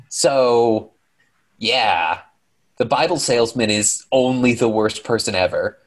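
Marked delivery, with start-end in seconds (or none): none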